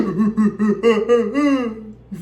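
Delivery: high, loud wheezing cackle; crackhead cackle. Retold or imitated. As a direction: cackle like the uncle's friend. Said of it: "his little friend used to be like".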